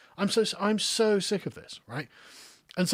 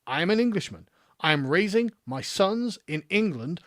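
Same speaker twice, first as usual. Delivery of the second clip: ordinary talk, nothing marked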